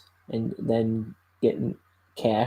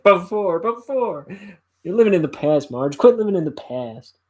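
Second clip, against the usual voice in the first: high, loud mimicking jeer; mocking comeback; 8 semitones higher, 6 dB louder than usual. Husband throws his wife's word back at her, mocking and loud. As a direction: parrot the word back, then berate.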